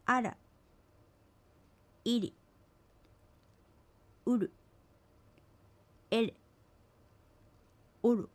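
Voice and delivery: "あら" with a small, blurred r sound at the end; indistinct final r. Shown as a demonstration. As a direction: let "おる" end in a faint r echoing its first vowel. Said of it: "曖昧な発音です".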